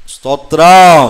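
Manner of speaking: a loud ringing cry of praise; shouted praise. Real, not acted. Preacher leading his congregation in praise, shouting. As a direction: call it out to the whole hall.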